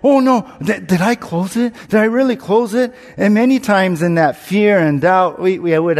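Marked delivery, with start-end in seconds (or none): 0.0-2.9 higher, anxious mock panic; imitating his own panic in the car; retold panic. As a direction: replay the rising worry, pitched up. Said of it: "I get this panic in my feeling".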